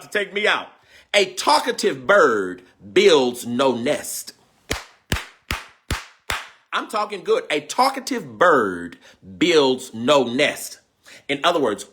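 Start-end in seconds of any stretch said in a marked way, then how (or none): 1.1-4.3 slow, weighted delivery; slow deliberate reading. Real, not acted. Savoring the proverb. 7.5-10.8 slow, weighted delivery; slow deliberate reading. Real, not acted. Proverb repeated.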